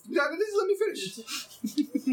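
high, insistent plea to finish. Real, not acted. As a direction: cut in, pitch jumping up. Insists on finishing his point.

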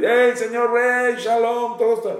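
mock hailing the king from afar; mock yell at king. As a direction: holler it at the king, disrespectfully. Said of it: "no es honor llegar a gritar al rey".

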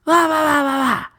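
high, drawn-out drunk-girl imitation; mock a belligerent drunk in a very high voice. Drunk girl refusing her friend's help, imitated in a high voice. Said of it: "The drunk girl's like".

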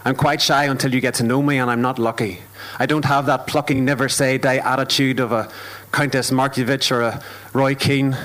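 steady rhythmic poetry performance; spoken word poem. Acted. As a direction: perform it like a stage poem, measured and deliberate.

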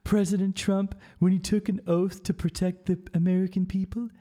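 a prissy Pelosi parody; mocking Nancy Pelosi. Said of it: "she's like".

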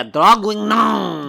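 Draguignan drawn out slow in an exaggerated French voice; mock French. Shown as a demonstration. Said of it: "Let me see it".

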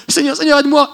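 pleading shout to the Lord; desperate cry for help. Retold or imitated. Preacher mimics desperate cry.

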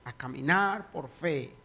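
prayer swelling to loud preaching; fervent prayer. Acted. Pastor praying aloud over the congregation, fervent prayer. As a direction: pray with growing fervour.

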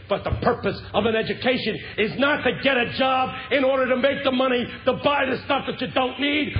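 high, loud pulpit climax; preaching at full force. Acted. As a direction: drive the point home to the congregation.

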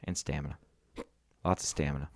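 low, quiet robot monotone. Pretend. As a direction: stiff robot talk, barely moving. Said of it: "I will talk like a robot right here".

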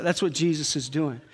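usual speaking voice

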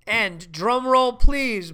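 loud, slow announcement; drum roll call. Pretend. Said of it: "That's my drum roll".

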